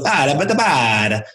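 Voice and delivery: sing-song syllables rising and falling in pitch; melodic pitch demo. Shown as a demonstration. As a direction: move the voice up and down like a tune. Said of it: "if my voice goes".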